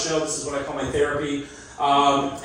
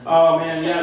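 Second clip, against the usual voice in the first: ordinary talk, nothing marked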